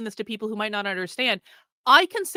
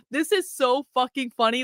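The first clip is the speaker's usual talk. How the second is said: ordinary delivery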